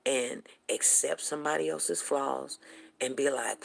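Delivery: usual speaking voice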